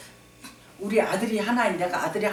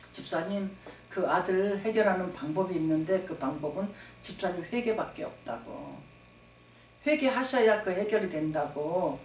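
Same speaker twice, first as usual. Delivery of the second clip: gentle advice; 4 semitones lower, 9 dB quieter than usual. Retold or imitated. Pastor gently advising on phone.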